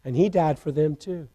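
low, very soft aside; hushed afterthought. Real, not acted. Preacher adding that Jesus died for the soldiers too, hushed. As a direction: drop right down, almost to yourself.

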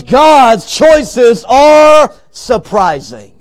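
slow, loud, long-drawn proclamation; emphatic preaching. Real, not acted. Preacher closing the point for the congregation, emphatic. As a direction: ring out the refrain, stretching it wide. Refrain as climax.

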